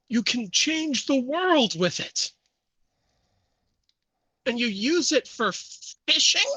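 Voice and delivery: angry, high-pitched incredulous rant; Charles. Acted. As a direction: spit scorn at a rival, pitch climbing. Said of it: "You can feel the anger emanating off of him".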